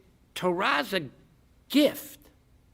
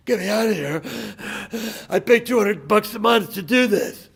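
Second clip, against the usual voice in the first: loud, panting, higher-pitched reply; breathless plea; 5 semitones higher, 7 dB louder than usual. Acted. Exhausted gym guy to alien, acted breathless plea. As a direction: gasp it out between heavy breaths.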